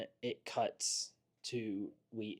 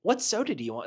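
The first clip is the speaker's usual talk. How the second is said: eager assistant; 5 semitones higher, 4 dB louder, much faster than usual. Retold or imitated. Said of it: "he's like".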